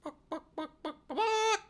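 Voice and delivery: very high chicken buck-buck; chicken clucking. Shown as a demonstration. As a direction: cluck like a hen in a squeaky voice. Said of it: "it's just the sound of a chicken".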